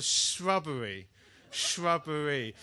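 "shrubbery" with an imperfect opening "shr" sound; "shrubbery" said as if the top lip can't quite feel it; mispronounced word. Real, not acted. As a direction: try "shrubbery" slowly, slightly fumbling the first consonants. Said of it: "I can't say shrubbery properly".

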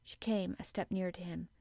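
usual speaking voice

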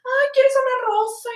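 high, drawn-out mock sales pitch; rose seller's pitch. Street seller offers her a rose in Rome, mock sing-out high and slow.

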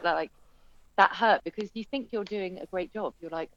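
ordinary talk

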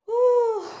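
loud, high "whew"; calming exhale. Acted. Speaker tells listeners to breathe, demonstrative whew. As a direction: blow out a big high whew.

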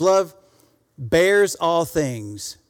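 very slow, stretched pulpit line; drawn-out sermon stress; slow emphasis. Acted. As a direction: lean hard on bears.

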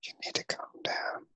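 soft unvoiced whisper. Real, not acted. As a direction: hush it back calmly. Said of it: "You're still whispering?".

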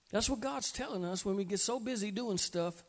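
usual speaking voice

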